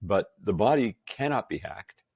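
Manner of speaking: ordinary talk, nothing marked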